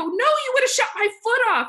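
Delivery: high, loud comic outrage; retold alarmed protest. Her alarmed retort to the officer.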